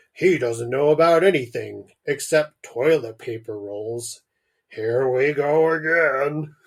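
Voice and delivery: put-on dad voice, slowing on the last words. Scowling Dad answers Mum about Richard, put-on dad voice. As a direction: play the scowling father, drawing out the end. Dad's reply in his character voice.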